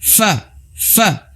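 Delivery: fa, sounded out high; letter fa. Shown as a demonstration. Teacher demonstrating fa for students. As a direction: model fa clearly. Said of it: "ini bibir atas".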